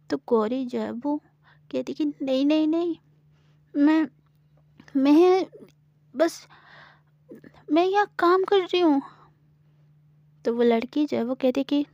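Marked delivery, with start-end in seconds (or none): 2.1-9.0 put-on higher, stammering frightened girl; flustered denial. Gauri nervously denies being a thief. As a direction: stumble over your words, then rush the excuse.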